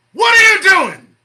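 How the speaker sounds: sudden high, loud yell; challenging shout. Real, not acted. Host demanding what listeners are doing, shouting. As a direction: burst out at the audience.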